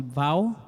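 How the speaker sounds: "Vau" ending in an open ao glide; "Vau" as one gliding syllable, no final consonant; alternate pronunciation. Shown as a demonstration. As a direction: pronounce "Vau" close to "bao". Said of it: "algunas veces se pronuncia".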